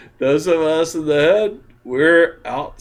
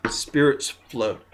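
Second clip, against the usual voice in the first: usual speaking voice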